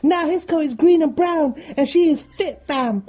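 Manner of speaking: high, loud laddish voice; high laddish cheeky voice; cheeky teen. Retold or imitated. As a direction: play a giddy teenage lad. [name] describes the stranger.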